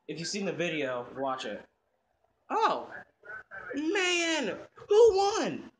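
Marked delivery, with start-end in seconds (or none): 2.5-5.7 high-pitched, eager outburst; excited, high kid voice. Acted. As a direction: burst out wanting the result. Eager child asks about race.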